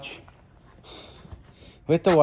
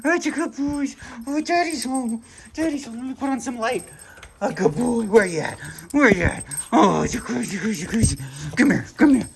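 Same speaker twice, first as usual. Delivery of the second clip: high, sing-song dog talk; 9 semitones higher than usual. Real, not acted. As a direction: coo at the puppies. Greeting puppies, baby talk.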